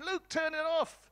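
high, excited call to the screen; urging the hero. Retold or imitated. Lecturer voicing the audience urging Luke, high excited call. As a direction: egg Luke on like a fan in the seats.

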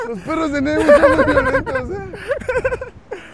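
high, loud, cracking up mid-sentence; laughing exclamation. Real, not acted. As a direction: talk through helpless laughter. Laughing at the dogs. (agudo, muerto de risa)

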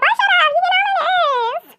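very high, stretched-out mimicked scolding; squealed mock telling-off; mocking imitation. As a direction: parody her annoyance way up high, dragging out the ending. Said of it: "She said it like that, y'all".

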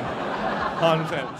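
hundred percent drawn out slowly through a laugh; slow, laughing repeat. Shown as a demonstration. Comic to crowd, repeating for emphasis, slow and laughing. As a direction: stretch it out slowly while laughing. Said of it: "a hundred percent".

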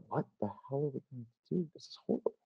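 hushed aside to a partner in bed; very soft, appalled whispering; horrified whisper. Retold or imitated. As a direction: whisper it in mock horror.

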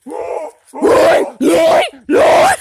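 loud howling call into the woods; Bigfoot call. Pretend. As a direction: bellow it like a creature call. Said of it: "we gotta make the Bigfoot call".

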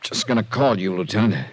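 half-stunned, words coming weakly; dazed and strange. Acted. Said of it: "You sound very strange".

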